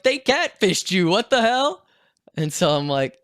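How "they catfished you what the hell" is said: much higher and louder, outraged; outraged yell. Retold or imitated. Friend outraged that he was catfished, shouting much higher. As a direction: shout it in disbelief.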